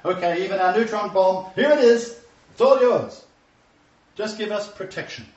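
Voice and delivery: loud, high mock surrender, easing at the end; acted desperate surrender. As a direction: call it out as a people handing everything over. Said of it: "the Israelis to say".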